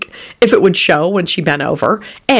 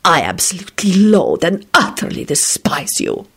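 restrained, emphatic venom; malevolent hiss. A narrator's reading. Radu insulting his cellmate, malevolent. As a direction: lean on each word of loathing. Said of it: "Radu hissed malevolently".

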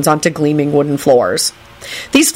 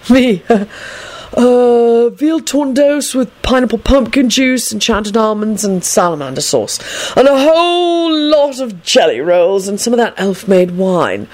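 higher, hesitant start then drawn-out wish list; dazed young man ordering food; 6 semitones higher, slower than usual. A narrator's reading. Young man orders lunch from his companion, dazed and drawn out. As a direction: draw out the order in a character voice.

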